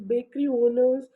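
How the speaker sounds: ordinary talk, nothing marked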